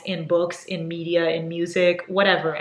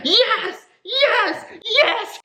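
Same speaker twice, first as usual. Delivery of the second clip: high, giddy cheering; thrilled squeal; much slower than usual. Real, not acted. Reader finding an excerpt of the next book, thrilled squeals. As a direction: cheer each one, very high.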